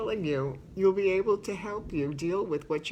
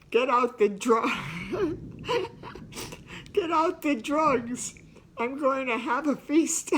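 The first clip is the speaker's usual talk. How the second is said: quoting him through bursts of laughter, higher; can't get the line out for laughing; laughing quote; 6 semitones higher than usual. Retold or imitated. Wife quoting her husband's joke, laughing.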